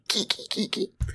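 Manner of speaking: high unvoiced raccoon chitter; squeaky kiki raccoon noise. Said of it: "That really sounded like a raccoon".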